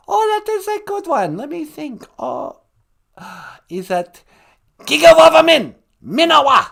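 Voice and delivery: high pondering, then loud attempt; theatrical high-voiced pupil; high-pitched guest character. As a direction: think aloud in falsetto, then belt the answer.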